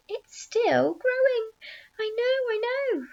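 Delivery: high, thrilled exclamations; excited shouting. A narrator's reading. Aunts hopping round the tree, high thrilled cries. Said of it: "shouting all sorts of silly things in their excitement".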